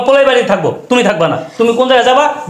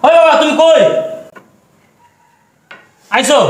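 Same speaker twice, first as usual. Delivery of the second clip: calling out; 5 semitones higher, slower than usual. Acted. Son calls father from afar.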